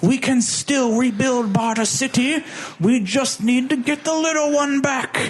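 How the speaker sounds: high, put-on villain queen voice; a lightly hammed-up ruler rallying her army; Auntie voice. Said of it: "So Auntie's like".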